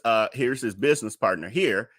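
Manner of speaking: ordinary talk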